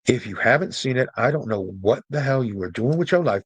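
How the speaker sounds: ordinary talk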